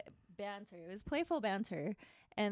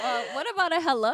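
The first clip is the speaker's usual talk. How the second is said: much higher, louder, fast retort; sassy comeback; 10 semitones higher, 13 dB louder, faster than usual. Retold or imitated. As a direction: snap back with sass. Retold comeback to rude remark.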